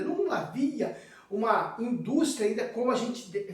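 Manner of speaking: ordinary delivery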